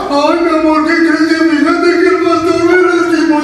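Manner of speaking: mock a man complaining like a child; husband whining to wife about the pastor; whiny husband. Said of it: "Y eres varón".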